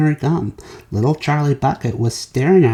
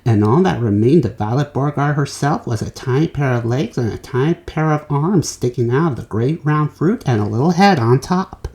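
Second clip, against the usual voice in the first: usual speaking voice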